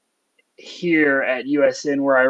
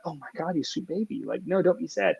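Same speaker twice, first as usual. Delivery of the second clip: put-on much softer, cooing to a sad kid; tender comfort; 3 semitones higher, 10 dB quieter than usual. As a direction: soothe the child gently, almost hushed. Comforting a sorry student.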